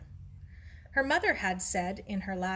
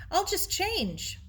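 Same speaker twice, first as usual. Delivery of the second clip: higher, slow call to someone behind her; calling over her shoulder; 12 semitones higher, slower than usual. Acted. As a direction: call it back while running off. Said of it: "she called over her shoulder".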